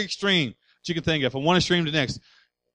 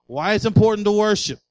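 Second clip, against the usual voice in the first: normal talking voice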